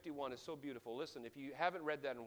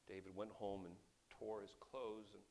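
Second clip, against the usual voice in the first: normal talking voice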